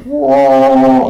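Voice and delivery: high hooting mock gibbon call. Imitating the guide's shitty gibbon call, high hoot. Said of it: "he let out a gibbon call".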